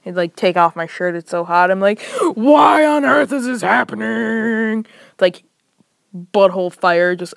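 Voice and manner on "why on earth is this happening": slow, drawn-out pained shout; anguished yell. Retold or imitated. Alone in the bathroom, slow pained shout. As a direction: cry out slowly in distress.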